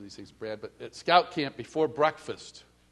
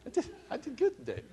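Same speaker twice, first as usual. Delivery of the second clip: pleased reply; 10 semitones higher, 9 dB quieter, faster than usual. Retold or imitated.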